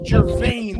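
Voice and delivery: ordinary talk, nothing marked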